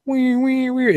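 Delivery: high wailing siren noise; siren imitation. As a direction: wail like a police car.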